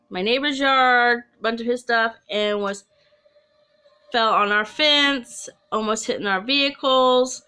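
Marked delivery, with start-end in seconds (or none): none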